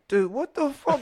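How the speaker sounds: high-pitched disbelief; mock a stunned bartender, pitch jumping up; exasperated, high retort; incredulous bartender.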